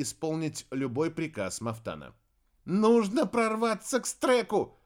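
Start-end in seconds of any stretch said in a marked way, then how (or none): 2.6-4.8 high, loud urgent shout. A narrator's reading. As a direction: shout over the brawl. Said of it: "крикнула Зарифа".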